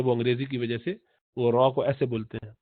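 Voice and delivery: ordinary delivery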